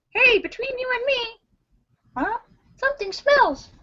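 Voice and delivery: very high, squeaky little voice; squeaky talking eye. Acted. One eye confiding to the other eye, squeaky voice. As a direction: replay it in a tiny high-pitched cartoon voice.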